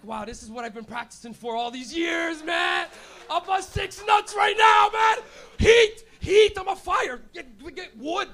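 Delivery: hammed-up survival-mode shouting; mock frantic shout. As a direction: bellow it like a freezing madman. Acted survival jerk-off rant.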